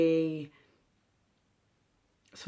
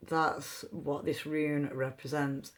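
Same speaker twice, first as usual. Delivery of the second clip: ordinary talk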